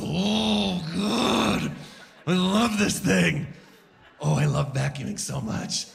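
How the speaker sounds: high, slow, dreamy gush; swooning love. Acted. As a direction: swoon over the vacuum. Swooning over his vacuum.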